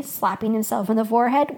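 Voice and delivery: ordinary delivery, nothing marked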